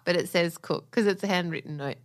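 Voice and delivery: ordinary delivery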